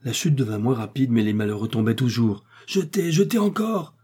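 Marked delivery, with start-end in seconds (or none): 2.6-3.9 high-pitched urgent plea; desperate cry. A narrator's reading. Doctor urging his companions to lighten the balloon, desperate cry. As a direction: cry out the order high, without a full shout. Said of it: "s'écria une dernière fois le docteur".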